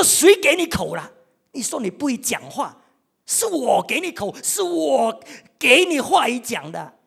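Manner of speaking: loud, high rebuke, last line slowed; God scolding. Retold or imitated. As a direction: scold Moses as an angry God. God scolds Moses, acted quote. (神大声责备摩西)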